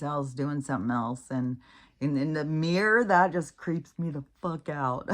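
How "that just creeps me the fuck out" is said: ordinary talk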